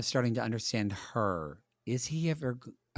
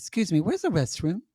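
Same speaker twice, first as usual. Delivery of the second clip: higher, light British-style inflection; imitated British lilt; 7 semitones higher than usual. Tourist asking in London, lightly British inflection. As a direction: lift the line like a half-learned British accent. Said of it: "my inflection would go where I would say things like".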